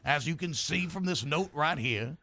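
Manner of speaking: firm but courteous Southern demand; lightly played genteel Southern voice; insistent Southern gentleman. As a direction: insist politely as a Southern gent.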